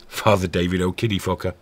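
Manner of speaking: ordinary talk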